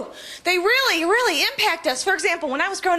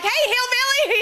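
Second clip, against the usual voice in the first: teasing call; 8 semitones higher than usual. Retold or imitated. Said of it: "I'm like".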